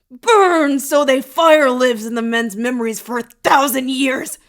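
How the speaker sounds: high, loud, frenzied roar; vengeful shout. A narrator's reading. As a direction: bellow the curse in full rage. Villain's outburst of vengeful rage.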